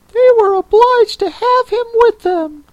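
very high creature voice; Mock Turtle. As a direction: squeak it out in a tiny, very high voice.

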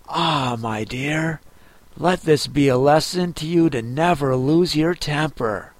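put-on a gentle old lecture to her daughter; old mother crab.

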